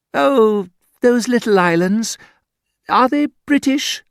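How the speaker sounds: put-on high, loud, gushing English girl. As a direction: coo it like a posh naive girl. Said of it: "so much nicer to ask".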